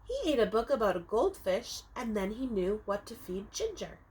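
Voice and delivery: ordinary delivery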